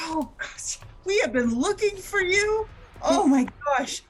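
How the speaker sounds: thrilled gasp of discovery; excited surprise. Acted. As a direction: burst out with joyful shock. Finding the missing person.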